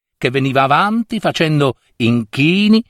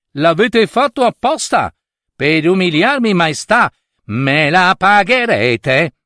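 offended Portuguese prince; 5 semitones higher than usual. Acted. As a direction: stretch out the final threat like a wounded prince. Humiliated Portuguese prince threatens the king.

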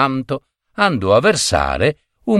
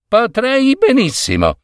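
higher-pitched thinking aloud; musing to himself; 12 semitones higher than usual. A narrator's reading. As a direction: weigh up a tempting idea. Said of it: "diceva Biagio fra sé". (riflessione fra sé)

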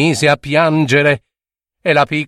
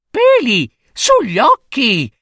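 very high mocking child voice with giggles; high sing-song teasing rhyme; teasing little girl; 9 semitones higher than usual. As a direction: taunt the monster cheekily. Girl's taunt.

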